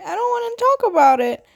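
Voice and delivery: retold high-pitched protest; insistent refusal. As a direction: push it up high, imitating an upset refusal. Retold refusal to mom, repeated.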